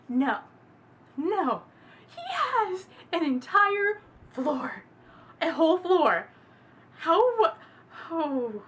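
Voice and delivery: loud, slow stunned disbelief; can't believe the size of it. Real, not acted. Disbelief at the entire floor.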